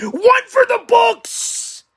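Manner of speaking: high, slow and loud; big showman's flourish; hype. Acted. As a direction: stretch it out like a ring announcer. Hyping last week's show.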